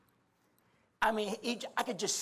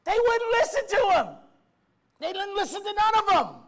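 high, loud fiery preaching; impassioned shouting sermon; preaching at full force; 5 semitones higher, 5 dB louder than usual. Acted. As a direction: hammer the point to the congregation.

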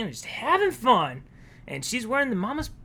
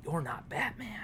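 much softer, lower dawning realisation; a hint of Joker noticing; lightly voiced Joker, quiet surprise; quietly puzzled Joker; 5 semitones lower, 14 dB quieter than usual. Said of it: "he's just like".

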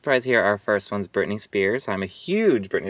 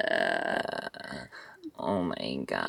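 deep creaky glottal fry, much lower and softer; vocal fry; 8 semitones lower, 8 dB quieter, much slower than usual. Shown as a demonstration. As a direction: drop into a lazy creak at the bottom of the voice. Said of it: "That's a glottal fry".

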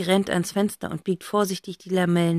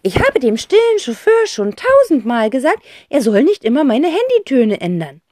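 high, loud indignant aunt; complaining aunt; 6 semitones higher, 6 dB louder than usual. A narrator's reading.

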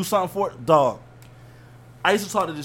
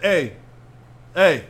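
louder, spaced-out calls across rooms; calling out; 6 dB louder, much slower than usual. Acted. Dad calls from back room to family, calling out. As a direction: holler and wait for an answer.

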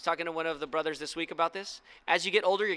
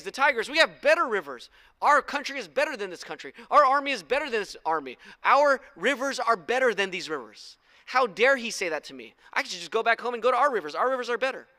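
loud, raised, boastful rant; mock outrage of an offended general; indignant general; 6 semitones higher, 8 dB louder than usual. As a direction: rant with wounded national pride, hammering each comparison. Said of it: "he's so mad".

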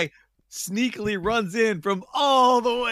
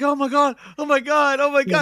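amazed crowd; 8 semitones higher, 5 dB louder than usual. Retold or imitated. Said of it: "everybody's like".

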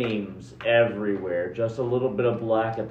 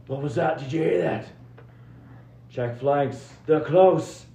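alarmed soldier; 6 semitones higher, 4 dB louder than usual. Acted. GM voices enemy soldiers.

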